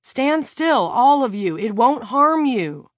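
very loud urgent shout. A narrator's reading. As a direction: command them to freeze, loud. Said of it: "Lila shouted".

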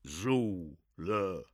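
uneasy gruff repeat. Acted. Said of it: "repeated uneasily".

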